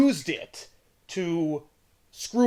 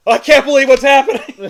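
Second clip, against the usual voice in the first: high, loud mock disbelief; Scarlet Witch; 8 semitones higher, 8 dB louder than usual. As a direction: play a hammed-up comic-book heroine exclaiming. Voicing Scarlet Witch's lines in story.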